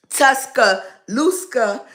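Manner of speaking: Tuscaloosa very slowly, broken in two, "Tuska... looska"; botched pronunciation. Real, not acted. Guest gives up on the town's name, slow botched try. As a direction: labour through Tuscaloosa with a stray k at the end. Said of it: "We calling you T A".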